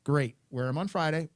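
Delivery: ordinary talk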